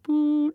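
high hummed note; imitated note. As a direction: hum the orchestra's note high. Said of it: "cued from the orchestra behind".

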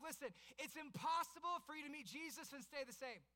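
building from lively telling to high, loud preaching; preaching at full force. Acted. Pastor urging the congregation that Jesus changes lives, preaching at full force.

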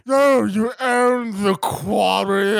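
snooty rich guy. Retold or imitated. Chip voiced in retelling.

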